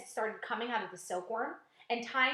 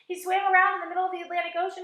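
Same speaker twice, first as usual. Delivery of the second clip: excited gush; 9 semitones higher, 10 dB louder, faster than usual. A narrator's reading. Centipede excitedly praising James.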